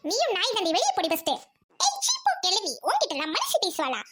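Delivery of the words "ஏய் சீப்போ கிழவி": high, scornful retort. Acted. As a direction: spit the insult at the old woman. Quarrel between two old neighbours.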